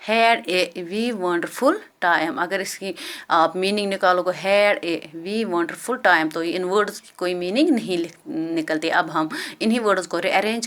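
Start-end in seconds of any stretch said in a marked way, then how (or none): 0.1-2.4 slow dictation. Shown as a demonstration. Reads jumbled words very slowly. 4.3-6.3 slow dictation. Shown as a demonstration. Repeats jumbled words slowly.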